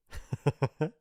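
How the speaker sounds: short mocking laugh, slightly high; gloating chuckle. Bully over a doubled-over victim, gloating laugh. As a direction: chuckle at their pain.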